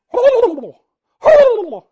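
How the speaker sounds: high loud squawking call; loud turkey call. Shown as a demonstration. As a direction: blast a harsh turkey yelp. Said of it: "you do that kind of call".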